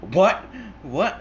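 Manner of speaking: high, rising, bewildered yelp; imitated interrogation victim. Interrogated man to hitman, rattled questioning. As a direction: play the cornered man, clueless and rattled. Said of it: "the guy is getting interrogated".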